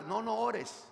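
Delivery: ordinary talk, nothing marked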